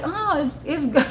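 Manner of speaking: lightly mimicked man, high and amused; Larry. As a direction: imitate his approval with a laugh creeping in. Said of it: "He was like".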